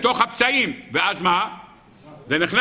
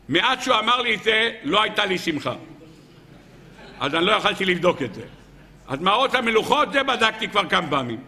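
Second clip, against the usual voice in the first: normal talking voice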